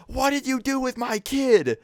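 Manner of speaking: very high, mock-shrieked accusation; frantic angry mom. Mom to bus driver at the door, frantic accusing. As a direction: demand it in a high-pitched, hammed-up mom voice.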